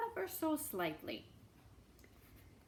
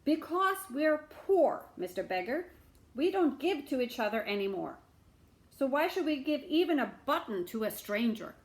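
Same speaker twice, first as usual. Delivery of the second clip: higher, louder complaint; bitter shamus; 5 semitones higher, 6 dB louder than usual. Acted. Caretaker explaining the town's poverty, bitter.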